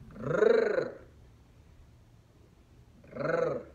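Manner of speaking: "rrr" as a long rolled trill; sonido de la R. Shown as a demonstration. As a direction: roll the tongue on "rrr", sound only. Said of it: "el sonido". ("rrr" con vibración larga de la lengua)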